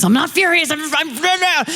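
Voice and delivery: high, spluttering mock fury; retold furious denial. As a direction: protest in a high voice, spluttering to a stop. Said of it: "Well, you're furious".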